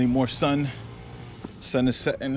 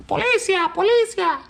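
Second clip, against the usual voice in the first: high, sing-song police siren call; siren imitation; 4 dB louder than usual. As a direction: mimic a siren in a falsetto. Mimicking the toy police siren.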